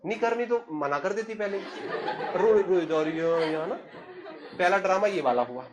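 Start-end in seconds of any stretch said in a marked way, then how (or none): 1.6-3.8 laughing through the words. Real, not acted. Brother laughing as he recalls his sister crying, laughing. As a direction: chuckle while describing the crying.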